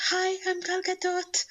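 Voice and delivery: tiny, high-pitched mock greeting; teasing squeaky-small impression. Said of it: "like, tiny".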